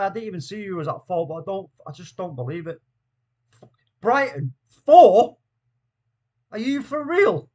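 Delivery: usual speaking voice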